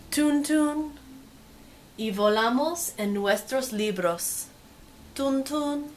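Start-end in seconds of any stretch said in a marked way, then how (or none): none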